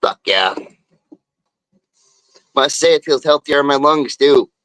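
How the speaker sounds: normal talking voice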